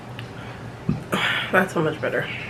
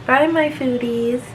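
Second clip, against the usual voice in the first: high, slow sing-song goodbye; bright farewell to viewers; cheerful outro; 6 semitones higher, slower than usual. Real, not acted.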